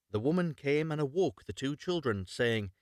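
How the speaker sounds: ordinary delivery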